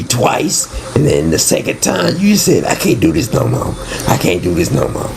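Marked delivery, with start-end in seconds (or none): none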